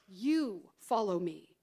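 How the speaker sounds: slow, low, drawn-out "You"; firm redirection leaning on "You"; stress on YOU. Acted. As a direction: hold "You", then settle on "follow me". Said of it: "emphasize the word "you".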